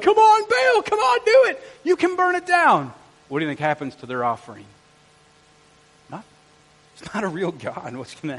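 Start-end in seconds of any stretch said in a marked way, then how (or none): 0.0-2.9 high, loud mock pleading to the heavens; calling on a god. False prophets begging Baal to send fire, high calling shout. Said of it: "they start calling down fire from their God".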